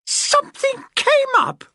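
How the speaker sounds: angry solicitor echoing the desk man, screeching; outraged screech. A narrator's reading. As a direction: screech the words back at him. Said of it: "the woman screeched".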